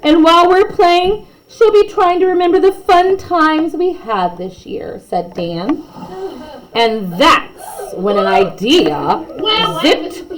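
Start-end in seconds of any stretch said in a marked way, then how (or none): 0.0-5.0 lightly voiced child, higher; kid voice. Dan talking about the teacher, lightly voiced child. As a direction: play an earnest schoolkid. Child character quoted in story.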